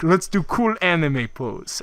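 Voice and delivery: put-on halting foreign-accented suggestion; mock broken English. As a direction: imitate non-native speakers, word by word. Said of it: "Japanese guy who can't speak English at all".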